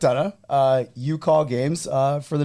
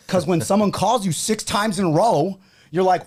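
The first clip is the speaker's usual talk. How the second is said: usual speaking voice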